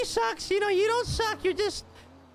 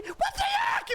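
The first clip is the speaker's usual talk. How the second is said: high shouted what the heck; mock outrage; 13 semitones higher, slower than usual. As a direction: scoff in disbelief. Outraged comment after quote.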